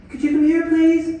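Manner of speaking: mock sugary wife calling her husband; sweet wife voice. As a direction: coax him over, sing-song sweet. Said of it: "A woman can make a man do".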